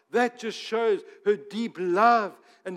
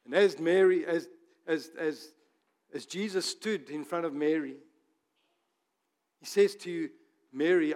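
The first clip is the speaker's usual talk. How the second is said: ordinary talk, nothing marked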